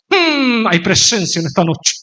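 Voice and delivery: a lightly hammed solemn preacher's hum and line; mock the affected preacher voice; put-on pulpit voice. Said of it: "se paraba en el púlpito y decía".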